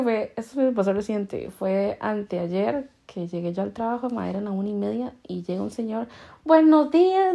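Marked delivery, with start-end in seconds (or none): 6.4-7.3 high, loud, cheery mock greeting; imitated happy greeting. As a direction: imitate a stranger greeting all happily. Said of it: "todo feliz". (saludo imitado, alto y todo feliz)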